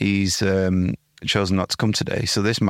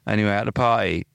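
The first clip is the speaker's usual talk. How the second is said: normal talking voice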